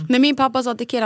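ordinary delivery

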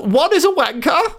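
mock royal voice, pitched well up; posh Queen voice. Host mocking the Queen's voice, high posh mock. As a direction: play the Queen saying something rude. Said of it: "So are you".

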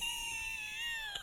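high, squealing laugh fit; helpless laughter. Real, not acted. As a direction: burst out in a real laughing fit. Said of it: "I don't know why I'm laughing".

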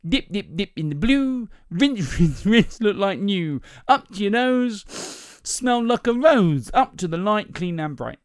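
high sing-song demonstration with stretched endings; salesman's demo patter. Acted. Salesman demonstrating with her skirt, sing-song patter. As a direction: pitch it up and draw out each rhyme.

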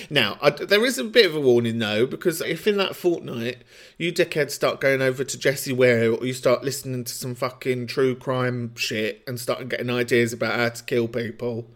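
normal talking voice

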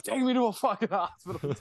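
high-pitched mock panic; frantic plea. Calling his brother during alcohol withdrawal, imitated frantic plea. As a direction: re-enact a desperate cry for help, voice shooting up. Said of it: "I called my brother frantically".

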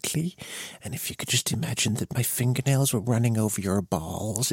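soft hushed mock ASMR; breathy-close parody whisper-talk. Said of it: "it's all like".

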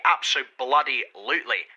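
"Absolutely" drawn out syllable by syllable; "Absolutely" stretched for emphasis; emphatic. Acted. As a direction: hammer out "Absolutely" slowly with an expletive wedged inside. Emphatic agreement with expletive inserted.

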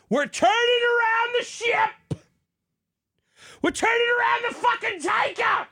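high, fervent shouting; rally the crowd at full pitch; overzealous preacher yell; zealous shout. Acted. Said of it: "I got a little heated there".

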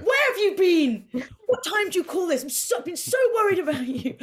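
high, loud mock scolding of the cat; half-furious, half-relieved parent at curfew. Telling off the cat who came home at midnight, mock stern. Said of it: "I was so happy and angry, like a teenager".

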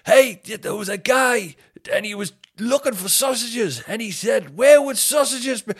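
a comic launching into material over a rowdy crowd; mock clueless opener. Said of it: "just went".

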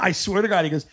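normal talking voice

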